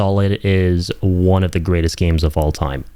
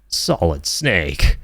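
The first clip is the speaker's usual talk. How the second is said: slow, weighty hero introduction; dramatic name reveal; 3 semitones lower, much slower than usual. Acted.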